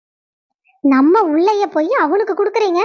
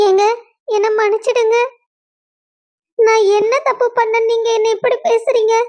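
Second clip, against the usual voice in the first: higher, begging plea for forgiveness; clinging on and pleading, pitched up; desperate pleading; 5 semitones higher than usual. Acted. Wife at her husband's feet begging forgiveness, pleading higher.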